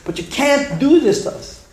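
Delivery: retold anguished plea; high crying plea. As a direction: beg him, voice climbing high and slow. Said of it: "cry and scream at the Rebbe".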